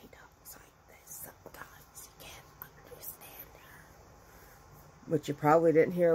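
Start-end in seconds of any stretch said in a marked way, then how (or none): 0.1-3.8 voiceless, barely-there imitation of a lost voice; laryngitis whisper. Showing how the cousin sounds with laryngitis, whispered. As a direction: mouth it slowly with almost no voice. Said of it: "She gets laryngitis frequently".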